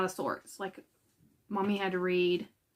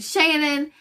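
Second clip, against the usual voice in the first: choked up; 3 semitones higher, 4 dB louder than usual. Real, not acted. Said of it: "Just got so embarrassed".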